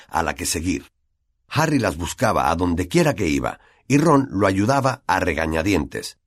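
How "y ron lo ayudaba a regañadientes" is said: ordinary delivery, nothing marked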